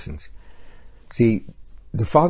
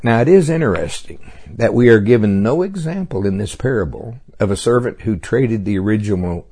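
usual speaking voice

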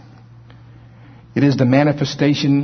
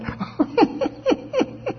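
muffled, high-pitched mock giggle; Sarah; 6 dB quieter than usual. Preacher acting out Sarah trying to muffle it, stifled laughing. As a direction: imitate a laugh being held in, very high and soft. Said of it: "Probably tried to muffle it".

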